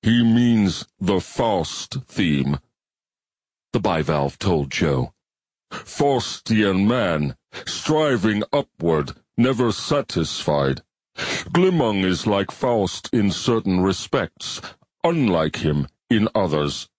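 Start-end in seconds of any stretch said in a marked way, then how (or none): 0.0-2.6 slow, measured alien voice with a different timbre; slow alien bivalve. Acted. 5.7-16.9 slow, measured alien voice with a different timbre; slow alien bivalve. Acted.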